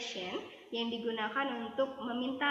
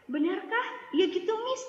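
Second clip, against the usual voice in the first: high, louder mock surprise; acted surprised response; 8 semitones higher, 5 dB louder than usual. Teacher playing a student reacting to gossip, acted surprise. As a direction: react eagerly, pitch jumping up. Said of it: "kamu bisa menjawab dengan".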